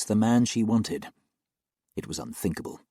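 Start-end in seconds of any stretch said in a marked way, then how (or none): none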